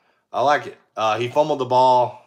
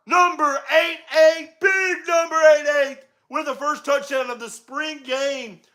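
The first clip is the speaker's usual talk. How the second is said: higher, energetic broadcast call of the game; live play-by-play; 9 semitones higher than usual. Acted.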